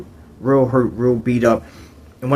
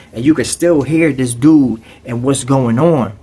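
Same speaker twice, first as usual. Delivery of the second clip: usual speaking voice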